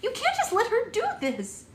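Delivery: a bride begging the fairy for help; desperate plea. A narrator's reading. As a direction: beg the fairy loudly. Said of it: "Red said".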